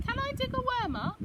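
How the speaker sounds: put-on high, bright child's question; eager child begging to dig a worm up. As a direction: ask like an eager little kid. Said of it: "I asked".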